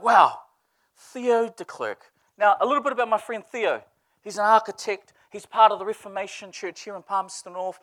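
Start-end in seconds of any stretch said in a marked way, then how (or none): 0.0-2.1 slow, drawn-out surprise at the caller's name; surprised. Retold or imitated. Pastor seeing his friend's late-night call, slow surprise. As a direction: stretch the name out, caught off guard. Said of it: "I'm like".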